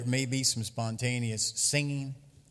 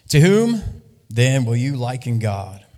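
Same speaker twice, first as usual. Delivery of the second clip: emphatic scripture reading; 8 dB louder than usual. Acted.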